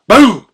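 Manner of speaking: loud, high blast of a gunshot; gunshot boom. Retold or imitated. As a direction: shout it like a rifle going off. Imitating the gunshot.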